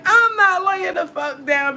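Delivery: high, slow, defiant; defiant refusal. Retold or imitated. Retelling her refusal to the boyfriend, high and defiant. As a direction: draw it out, refusing him. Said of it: "I'm like".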